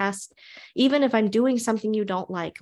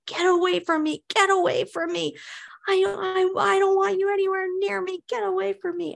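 put-on much higher, panicked child yelling; imitating a screaming child; 12 semitones higher than usual. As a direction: shove him away in a high child's voice. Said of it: "And he'll say".

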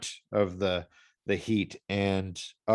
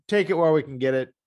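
excited; 7 semitones higher, 6 dB louder, much faster than usual. Real, not acted. Loud, fast burst.

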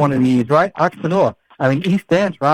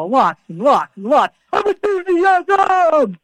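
much higher, very slow, stretched lot of enthusiasm; enthusiastic build; 12 semitones higher, much slower than usual. Acted. Host hypes enthusiasm for listeners. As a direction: pump up the word enthusiasm.

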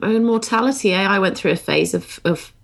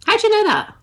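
much higher, amazed question; surprised; faster than usual. Real, not acted. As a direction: ask it in real disbelief.

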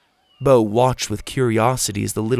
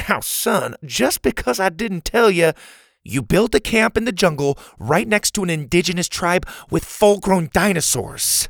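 put-on higher, stern older man; Pa's voice; 8 semitones higher than usual. Pa scolds Bo for his accusation, stern. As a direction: push back at the boy. Pa counters Bo.

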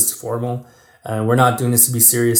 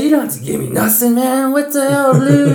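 much higher, louder, excitedly protesting a gift; imitating Tony's protest; 13 semitones higher, 5 dB louder than usual. Friend mimics Tony turning down the flag gift, high and excited. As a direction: squeak out a flustered, delighted refusal. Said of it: "He's like".

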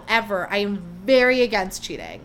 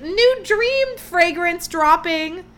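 mock unbothered announcement; 7 semitones higher, 4 dB louder, much slower than usual. Podcaster mocking the singer's Instagram post, slow and higher. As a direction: drag it out like she doesn't care. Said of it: "was like".

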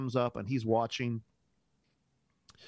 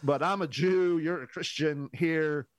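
higher-pitched imitated protest; mock objection; 6 semitones higher, 3 dB louder than usual. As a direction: balk in a playful, raised voice. Acts out man balking at prayer.

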